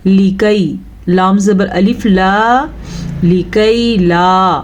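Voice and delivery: लिकै ला spelled letter by letter, slowly; slow careful spelling of लिकै ला; slow spelled-out reading. Shown as a demonstration. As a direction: teach लिकै ला syllable by syllable. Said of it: "ये बनेगा".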